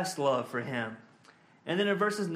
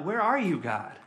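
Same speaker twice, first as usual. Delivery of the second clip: crying out. Retold or imitated. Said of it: "he cries out".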